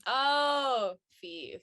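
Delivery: normal talking voice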